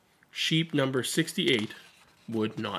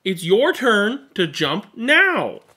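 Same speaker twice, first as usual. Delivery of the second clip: firm insistence; 6 semitones higher, 7 dB louder, slower than usual. Acted. Duncan insists.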